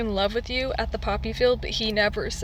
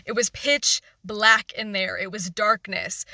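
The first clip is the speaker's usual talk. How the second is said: ordinary talk, nothing marked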